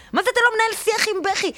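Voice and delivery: high, fast, disbelieving repeat; incredulous outburst. Real, not acted. Indignant narrator throwing the father's words back at him. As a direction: spit the quote back in disbelief.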